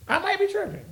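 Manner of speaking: a high, soft I might be tripping; high, soft self-doubt. Real, not acted. Fan doubting himself to viewers, high and soft. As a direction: float it up softly, half joking.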